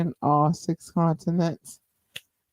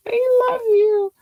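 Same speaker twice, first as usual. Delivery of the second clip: high, slow, louder sing-song falsetto; falsetto love; 5 dB louder than usual. Real, not acted. Host tells her viewers she loves them, high crooning falsetto.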